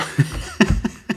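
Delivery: breathy, voiceless chuckle; wheezy self-amused laugh. Real, not acted. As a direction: laugh at your own bad attempt. Laughs at own bad accents.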